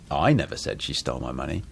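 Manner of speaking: emphasis on the first word, I; I picked out above the other words; stress on I. Shown as a demonstration. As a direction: lean on "I", rest unaccented. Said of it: "emphasis brings a lot of meaning".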